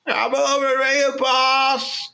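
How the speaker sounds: high servant voice. Acted. New character answers boss.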